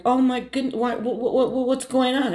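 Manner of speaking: high, stammering imitation of crying mom; tearful mom. Mom hears of a problem, tearful stammer. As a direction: imitate her upset, stumbling over words up high. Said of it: "She starts crying".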